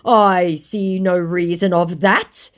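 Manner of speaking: very slow, loud, rising reply; stilted scammer. Acted. Scammer told he's not smart enough, mock stilted indignation. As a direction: drag out each word in a mock voice.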